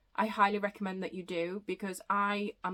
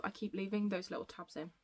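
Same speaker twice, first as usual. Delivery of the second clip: usual speaking voice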